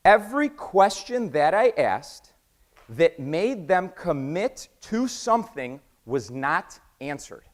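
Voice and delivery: slow, spaced-out "Every question that I asked"; emphatic word by word. Real, not acted. Speaker driving a key point home to the audience, slow emphatic stress. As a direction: punch each word slowly through "was not answered".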